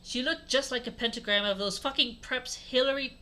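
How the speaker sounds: plain reading voice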